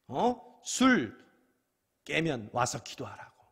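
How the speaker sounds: a hint of stern priest, loud on 술; mild stern priest. Priest Eli telling Hannah to sober up, mildly stern. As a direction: rebuke her lightly.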